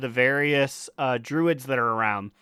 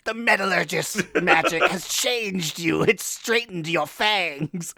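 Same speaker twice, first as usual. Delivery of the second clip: dragon with braces; 5 semitones higher, slower than usual. Retold or imitated. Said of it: "a primal dragon comes back with braces".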